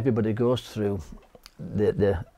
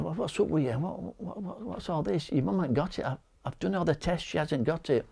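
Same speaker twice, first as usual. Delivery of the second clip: exasperated reassurance; 8 semitones higher, 5 dB quieter than usual. Retold or imitated. Wife says her mum has covid.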